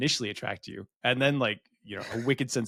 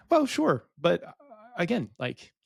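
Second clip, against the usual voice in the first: high-pitched, halting concession; conceding reply; 8 semitones higher, 3 dB louder than usual. Retold or imitated. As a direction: start loud and high, then hedge. His retold reply, voiced high.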